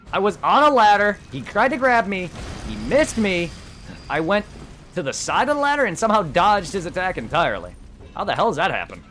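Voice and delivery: loud, high excited telling; amazed disbelief, voice up high. Real, not acted. Surprised dodge in game.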